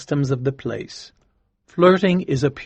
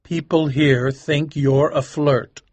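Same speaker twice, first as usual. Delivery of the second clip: seriously; 6 semitones higher, slower than usual. Acted. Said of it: "said Winterbourne seriously".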